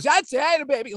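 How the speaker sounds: high, pumped-up cheer; pumped-up strikeout cheer; celebrating strikeout. Retold or imitated. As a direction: shout it like a pitcher fired up after a strikeout.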